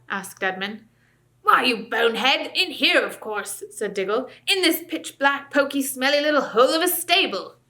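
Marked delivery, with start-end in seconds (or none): 1.4-7.6 higher, louder sneering dwarf voice; mock them as fools; scornful dwarf. Dwarf sneering at the children about the dark stable.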